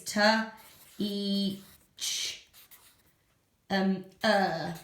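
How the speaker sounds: T, E, ch, er said one by one; segmenting teacher. Shown as a demonstration. Teacher shows a child's spelling of teacher. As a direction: sound out the alternative spelling. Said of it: "It might be spelt like this".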